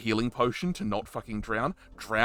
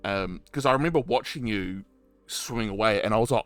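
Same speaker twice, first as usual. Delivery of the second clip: ordinary talk, nothing marked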